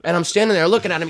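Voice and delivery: normal talking voice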